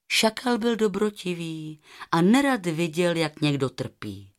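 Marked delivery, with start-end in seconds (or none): none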